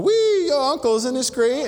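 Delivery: put-on high, loud gleeful whoop from kids; excited kids. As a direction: cheer it like thrilled boys on a raft. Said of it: "the boys in the boat going".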